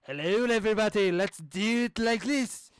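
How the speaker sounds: loud, hammy quest giver greeting, slowing at the end. Shown as a demonstration. Demoing a quest giver voice for the game, loud and hammy. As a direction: boom it out like a fantasy quest giver. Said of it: "one of the quest givers".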